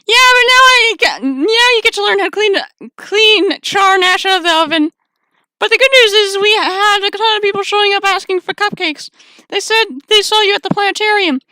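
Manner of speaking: high bright character voice; chirpy shopkeeper figure; cheery high-pitched boss; high voiced boss.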